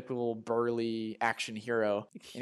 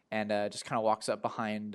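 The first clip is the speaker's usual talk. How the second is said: ordinary delivery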